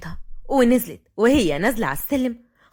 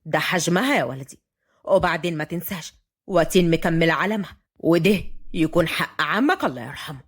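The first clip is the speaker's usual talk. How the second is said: low-pitched, firm elder; stern grandfather; 8 semitones lower than usual. A narrator's reading. Grandfather insists on Watin's right, stern. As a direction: lay down the law, voice lowered. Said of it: "رد الجد بصرامة".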